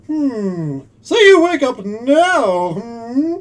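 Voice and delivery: high, loud, slow put-on gentleman's voice; a hammed-up, failed try at a British sleuth; mock British detective. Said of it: "I can't do British".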